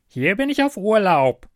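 high, loud goblin reply. A narrator's reading. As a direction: toss it off like a cheeky goblin. Goblin replies.

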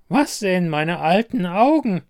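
high, loud crone voice; high-pitched croaking wonder; croaking old beggar woman. A narrator's reading. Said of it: "krächzte sie".

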